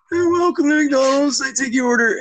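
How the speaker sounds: put-on high-pitched teen fast-food worker; high mock drive-thru greeting; teenage drive-thru kid. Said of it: "fucking sixteen-year-old fat kid".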